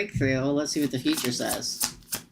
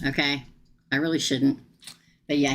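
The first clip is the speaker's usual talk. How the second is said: ordinary talk, nothing marked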